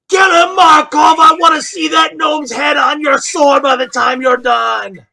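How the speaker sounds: bellowing fan cheering on a fighter; crowd shout. Acted. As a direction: roar it from the stands. Spectator shouting at arena.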